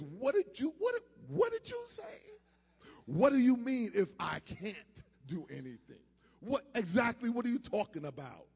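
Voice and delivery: acted indignant disbelief, pitch jumping up. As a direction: fire back questions as if affronted. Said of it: "he was indignant".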